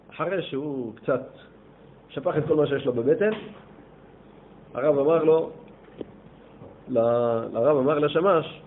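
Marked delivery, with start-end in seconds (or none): none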